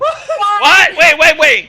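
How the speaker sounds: loud disbelief. Real, not acted. Friend reacting to the knockoff coffee brand, yelling in disbelief. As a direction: yell it like you can't believe your ears. Shocked at Starbits name.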